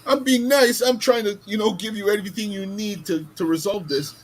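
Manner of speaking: put-on retelling the protest to the rude old woman, high and exasperated. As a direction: squeak it out in outraged disbelief. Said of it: "I use that kind of same kind of voice".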